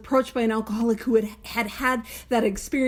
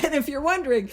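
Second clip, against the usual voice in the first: ordinary delivery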